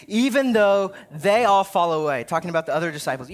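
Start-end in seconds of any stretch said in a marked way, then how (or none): 0.0-2.2 louder, a sharp retort as Peter; indignant snap. Retold or imitated. Peter snapping back at Jesus, louder mock indignation. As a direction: bristle back at the teacher in mock offence. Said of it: "he snaps back at Jesus in his Peter-like way".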